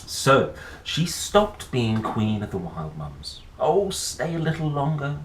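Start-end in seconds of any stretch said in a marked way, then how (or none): 3.6-5.2 wailing plea. Acted. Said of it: "the wild mums wailed".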